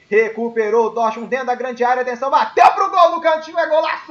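screaming, very high and loud goal call; explosive goal commentary. Real, not acted. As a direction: erupt into a full goal scream. Live goal call in match.

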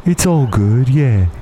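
put-on lazy British accent, slowed down; a chummy English cat greeting his mates. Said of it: "how I imagined this cat talked".

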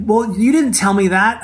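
high-pitched imitation of a defensive husband; mock defensive. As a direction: object high, as a put-on aggrieved partner.

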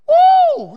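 high, loud whoop; loud woo. Real, not acted. Preacher whooping at his hard times, loud. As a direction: let out a big woo.

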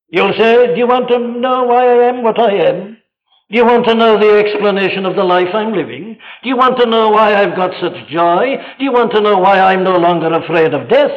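fast, high, urgent preaching; quick, impassioned pulpit questioning; fervent testimony. Acted. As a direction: fire off the questions with rising zeal. Testimony preached at full force.

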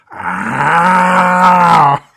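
loud, long open-mouthed aah; head thrown back, wailing a stretched-out aah; comic imitation of a gaping yell; Brian Regan. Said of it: "Brian Regan head back, ninety degree angle".

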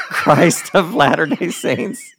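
loud, laughing, tripping over the words; flustered blurt. Retold or imitated. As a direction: blurt it out in a panicked rush. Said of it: "That's how I said it".

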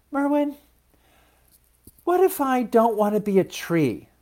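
high-pitched, wondering seed voice; dreamy child-like character, pitched well up; dreamy little seed. A narrator's reading. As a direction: wonder aloud in a small high voice.

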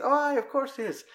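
high, fake-reassuring voice; Woody lying in a high squeaky voice; sly Woody. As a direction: cover up with a high breezy lie.

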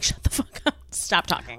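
harsh low whisper; hissed irritation. Retold or imitated. Annoyed whisper telling a gushing partner to shut up. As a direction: breathe it out through your teeth, fed up.

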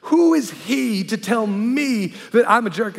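high, loud whining complaint; whiny friend. Retold or imitated. Pastor imitating his whining friend, high and loud. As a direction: whine like a wounded big guy. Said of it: "And he goes".

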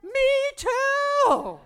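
high, loud joyful reply; excited me too. Retold or imitated. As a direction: echo her with glee. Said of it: "I said".

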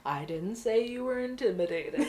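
imitated amused chuckle under the words; lightly amused, placating reply; chuckling. Lieutenant answering a defensive officer, imitated chuckling delivery. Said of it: "he just kinda chuckles and he goes".

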